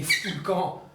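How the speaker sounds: subdued echo of father's shout; retold shout. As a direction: briefly raise the voice to quote the father. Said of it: "Mon père m'a crié". (cri du père rapporté, voix retenue)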